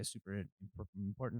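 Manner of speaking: soft, halting stumble on "important"; stumbling over IMPORTANT. Real, not acted. Game master hedging about what research might find, stumbling on "important". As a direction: break off at "im-", then quietly say "important". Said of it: "He stumbled over the word important".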